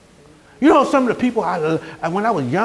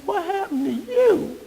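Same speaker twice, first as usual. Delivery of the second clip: higher, hushed, slow inner aghast question; inner disbelief; 10 semitones higher, 6 dB quieter than usual. Retold or imitated. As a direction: think it in shocked silence. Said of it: "But in my heart, I'm like".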